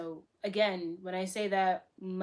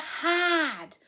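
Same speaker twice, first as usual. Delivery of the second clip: loud, high imitated Boston "hahd"; exasperated friend's Boston accent, R dropped; 8 semitones higher, 6 dB louder than usual. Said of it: "what she was saying was hard".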